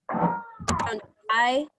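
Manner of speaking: long I held out clearly; modelled long I. Shown as a demonstration. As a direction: sing out the I for the class. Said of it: "what does the long I sound like".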